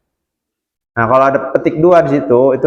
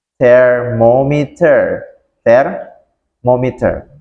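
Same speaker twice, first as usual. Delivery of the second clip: Thermometer drawn out very slowly, syllable by syllable; slow model; much slower than usual. Shown as a demonstration. Teacher to students, spelling Thermometer out slowly. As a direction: stretch Thermometer out so the class can follow.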